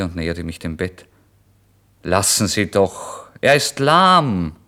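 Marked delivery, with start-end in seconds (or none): 2.0-4.6 slow, louder call from the landlord. Acted. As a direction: call it across the room, drawn out. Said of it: "rief der Wirt".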